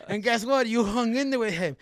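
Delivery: high, loud mock coach's praise; excited coach. Coach tells fighter he held his own, excited.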